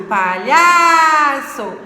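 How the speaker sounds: "palhaço" with a loud, greatly stretched LHA; exaggerated middle stress. Shown as a demonstration. Teacher showing the penultimate is strong, "palhaço" with long loud LHA. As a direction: swell and hold the lha in "palhaço". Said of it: "É olhar".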